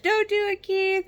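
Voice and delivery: high pleading cry of don't do it; retold scream. Heartbroken Nate yelling outside the church, mock high cry. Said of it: "he's just screaming outside the church".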